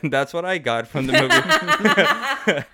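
high laughing giggle. Real, not acted. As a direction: laugh through the words. Laughing through line.

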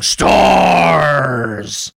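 loud, drawn-out monster bellow; yelling monster. Retold or imitated. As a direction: bellow it long like a lumbering monster. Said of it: "he rolls around yelling".

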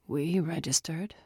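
croaked, hoarse whisper; croaking whisper. Acted. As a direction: croak it out weakly. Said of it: "Sal croaked".

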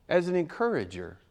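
usual speaking voice

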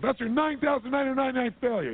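high, loud mocking retort; sarcastic jab. Buddy mocking Edison over another failure, high and scornful. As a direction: throw his failure count back at him.

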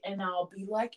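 normal talking voice

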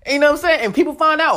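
normal talking voice

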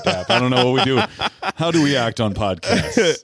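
amused laughing question. Real, not acted. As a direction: giggle through the question. Awkward handshake moment.

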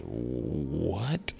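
soft, drawn-out rising question; stunned disbelief. Retold or imitated. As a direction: breathe out a stunned, questioning reaction. Stunned reaction to cancer claim.

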